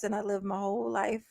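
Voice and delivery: ordinary talk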